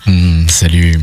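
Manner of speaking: low, breathy-free sultry greeting; sensual voice. Shown as a demonstration. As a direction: purr a low seductive hello. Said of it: "tu l'as fait comment, la voix sensuelle".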